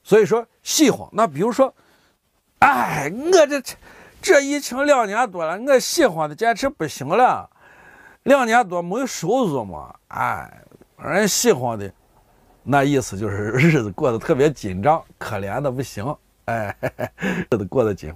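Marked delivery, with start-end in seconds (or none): 2.6-11.9 high-pitched dialect moan, sinking at the end; woeful hardship complaint. Acted. Struggling earner complaining of two years without income, high woeful lament. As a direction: act out a poor-me lament. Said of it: "我可怜的两年多都没收入了".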